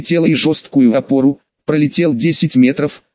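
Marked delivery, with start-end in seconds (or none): none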